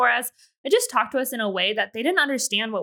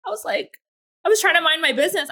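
normal talking voice

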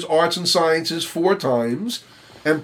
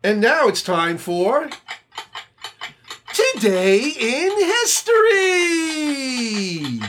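slow, higher, grand show opener; proper announcer; 7 semitones higher, slower than usual. Acted. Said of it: "You gotta go a more up, up, uh, proper".

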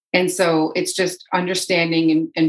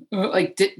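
usual speaking voice

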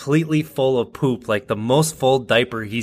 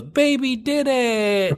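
put-on high, slow proud baby voice; toddler voice; 13 semitones higher, much slower than usual. Toddler announcing his success to parents, slow high baby voice. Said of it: "and then he goes".

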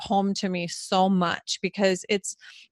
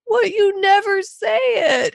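high, drawn-out mock wail; slow, loud, high-pitched imitated bawling; imitated tearful protest; 12 semitones higher, 5 dB louder, slower than usual. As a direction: retell it as a crying, pleading daughter. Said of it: "I literally broke down bawling".

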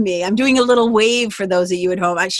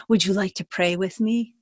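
calm soft question; 2 semitones lower, 5 dB quieter than usual. Retold or imitated.

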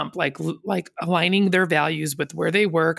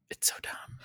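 exasperated whisper; 17 dB quieter than usual. Real, not acted. Reacting to people arguing cancel culture, exasperated whisper. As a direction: voice drops to a bare whisper.

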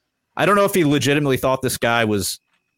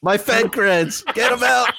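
mock whiny agent; 10 semitones higher, slower than usual. Host mocks the agent's plea.

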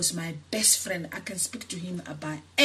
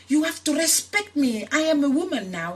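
furious retold outburst; 6 semitones higher, 5 dB louder than usual. To her mother who threatened to cut again, furious shout. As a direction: go ballistic at your mother, voice way up. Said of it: "And I went ballistic".

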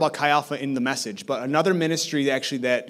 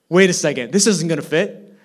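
loud, higher mock complaint about the tiny jersey; mock joking reaction; 4 semitones higher, 9 dB louder than usual. Husband joking over the baby jersey gift, loud mock complaint. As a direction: blurt it out as a playful fake reaction. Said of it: "No, I didn't say that".

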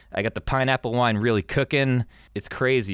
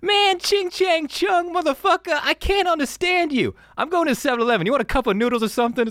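Chris Tucker impression. Podcaster imitates Chris Tucker's movie line, high mocking voice. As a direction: overblown mocking movie-character voice, pitched way up. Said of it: "I'm doing an impression".

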